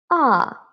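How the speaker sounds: AH falling from high; fourth tone. Shown as a demonstration. Teacher drilling first line, ah falling. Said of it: "now let's have a try".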